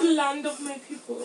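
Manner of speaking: high-pitched, badly faked British; hammy fake British voice; horrible British accent. Podcaster jokes about her homeland, bad British accent. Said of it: "Let me stop with that horrible accent".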